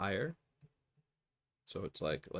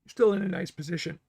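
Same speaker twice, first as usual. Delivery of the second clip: strained after coughing fit, higher, louder; choking on spit; 9 semitones higher, 7 dB louder, faster than usual. Real, not acted. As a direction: push words out while still choking. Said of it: "I'm choking on my spit".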